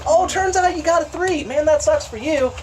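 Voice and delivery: high, gleeful mock sympathy; gloating taunt. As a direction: rub the enemy's failure in. Taunting enemy after failed save.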